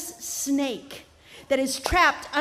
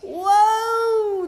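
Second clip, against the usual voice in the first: whoa; 7 semitones higher, 7 dB louder than usual. A narrator's reading. Narrator exclaims.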